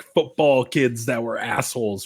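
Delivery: ordinary talk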